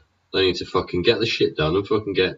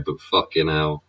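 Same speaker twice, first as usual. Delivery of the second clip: usual speaking voice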